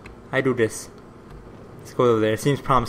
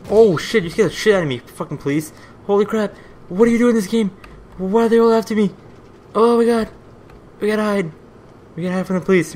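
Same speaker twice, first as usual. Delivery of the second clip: panicked yelling; 8 semitones higher, 5 dB louder than usual. Real, not acted. Chased by police in game.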